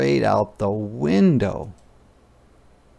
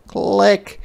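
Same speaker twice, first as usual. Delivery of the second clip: bright high camera click; click sound; 9 semitones higher, 4 dB louder than usual. A narrator's reading. Narrator mimics the camera shutter with a high click.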